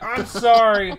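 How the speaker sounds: loud, high disbelief; acted incredulous outburst; incredulous "I'm sorry". Retelling his incredulous reaction.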